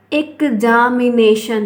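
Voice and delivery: एग्जामिनेशन said slowly, each syllable drawn out; teacher slowly sounds out एग्जामिनेशन; slow syllable demo. Shown as a demonstration. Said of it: "इसका pronunciation जब आप कर रहे हो".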